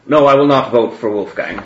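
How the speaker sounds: firm refusal. Acted.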